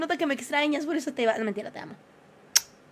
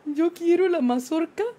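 retold answer; 2 semitones higher, 9 dB louder, slower than usual. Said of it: "Y yo le digo".

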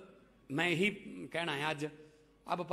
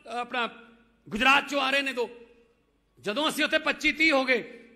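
loud, raised-pitch political oratory; rousing rally cry; 6 semitones higher, 8 dB louder than usual. Real, not acted. Politician urging supporters to win every seat, rousing and loud. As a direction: fire up the crowd, louder and higher.

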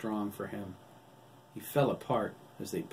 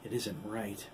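plain reading voice

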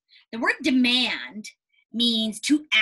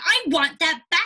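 demanding; 6 semitones higher, 6 dB louder than usual. Pretend. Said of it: "you said".

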